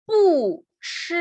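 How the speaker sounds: "bu" falling from high, "shi" held high and level; original fourth tone on bu. Shown as a demonstration. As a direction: drop "bu" hard, then sustain "shi" high. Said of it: "mantiene su tono original".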